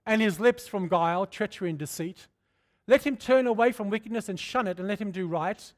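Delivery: fervent pulpit scripture reading; preached scripture. Acted. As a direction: proclaim the verse to the congregation. Preaching the verse.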